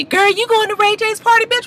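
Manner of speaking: high put-on girlfriend voice, shrill with glee; gossiping girlfriend. Friend calling Princess about the party, high gossipy voice. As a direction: squeal it like a messy friend spilling tea.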